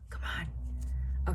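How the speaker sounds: whispered under breath. Real, not acted. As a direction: breathe it impatiently. Muttering at the truck noise.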